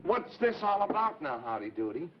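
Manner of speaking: ordinary talk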